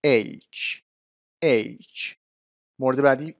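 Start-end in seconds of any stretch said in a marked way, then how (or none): 0.0-2.1 "اچ" as aych, with an ay glide; correct pronunciation. Shown as a demonstration. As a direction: repeat اچ slowly as EYCH. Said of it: "تلفظ درست این حرف".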